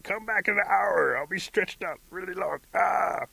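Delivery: high, loud, strained cry; pained shouting. Acted. Stretched rabbit calling to bear to return later, shouting in pain. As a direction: holler through the agony of being stretched.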